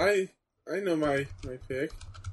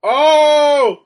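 loud, high "oh!" of shock; startled high yell; shocked shout; 11 semitones higher, 16 dB louder than usual. Real, not acted. Watching a big wrestling move land, shocked loud shout.

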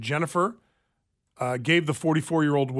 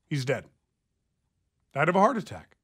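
ordinary delivery

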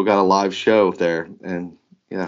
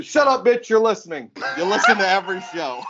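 high, laughing clap-back; mock scolding; 8 semitones higher than usual. Host answering the hate listeners, laughing mock scolding. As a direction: tell them off in a high voice, breaking into laughter.

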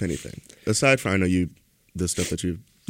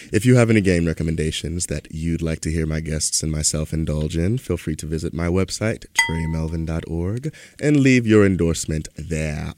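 ad read; 5 semitones lower than usual. Acted. Plugs website.

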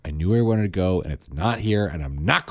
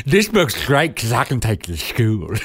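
put-on lateral lisp on the s sounds, child voice; excited little boy with a lisp; lisping Kentucky boy; 3 semitones higher, 4 dB louder than usual. Boy running to his brother with the book, lisping excitedly. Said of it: "perfect lateral lisp".

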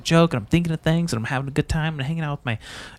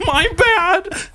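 much higher, hammed-up sarcastic sorry; high sarcastic whiny apology; mock apology; 10 semitones higher than usual. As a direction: whine a fake apology with a laugh. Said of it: "it's just like".

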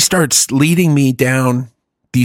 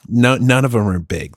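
ordinary talk, nothing marked

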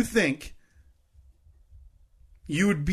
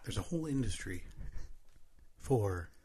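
ASMR whisper; 5 semitones lower, 13 dB quieter than usual. Pretend. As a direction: keep the hushed ASMR voice going. Keeps ASMR voice for a bit.

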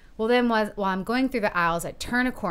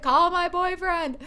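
panic; 11 semitones higher, 3 dB louder than usual. Retold or imitated. Panicking after fainting.

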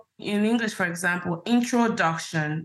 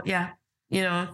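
ordinary talk, nothing marked

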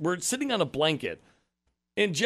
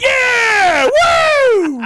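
very high, loud whooping cheer; mock crowd cheer; 15 dB louder than usual. Imitating the reggae crowd cheering the lyric, very high and loud. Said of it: "Everyone's like".

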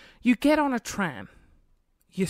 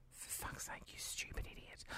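very soft, whispered cursing; hushed, exasperated insult; muttered contempt; 24 dB quieter than usual. Retold or imitated. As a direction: mutter it under your breath at them. Muttering at the fallen passenger.

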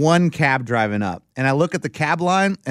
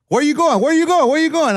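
much higher, louder repeated question; pushy cab driver; 12 semitones higher, 5 dB louder than usual. Retold or imitated. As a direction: rattle it off like an impatient cabbie. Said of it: "He's like".

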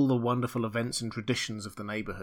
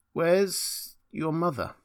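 slow, hesitant question, pitched higher; faltering voice; 5 semitones higher, much slower than usual. A narrator's reading. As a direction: ask haltingly, dreading the answer. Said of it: "he put it with a faltering voice".

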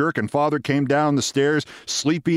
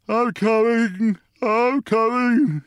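slow, high drawn-out wail; groggy father calling out, high and drawn out; sleepy wail; 8 semitones higher, much slower than usual. A narrator's reading. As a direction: wail it slowly, half asleep. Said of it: "he wailed in a slow, sleepy voice".

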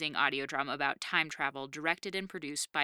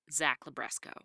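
usual speaking voice

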